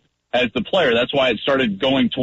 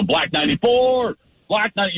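higher, called out like at the line; barking the cadence; 10 semitones higher than usual. Shown as a demonstration. Quarterback demonstrating a line call for his co-host, called out high. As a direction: sing out the play call to the huddle. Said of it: "we might go to, to ninety-four zone".

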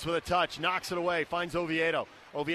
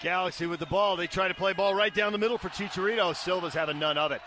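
normal talking voice

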